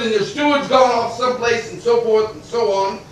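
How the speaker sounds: ordinary delivery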